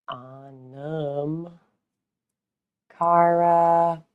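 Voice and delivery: very slow, spelled-out link; careful slow read-out; slow dictation. Real, not acted. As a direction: dictate it clearly, slowly. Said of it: "spelling out her link".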